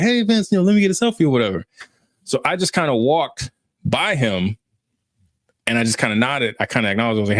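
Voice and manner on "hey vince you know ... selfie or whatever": high-pitched, gushing fan imitation; eager fan. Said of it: "one of those fans like".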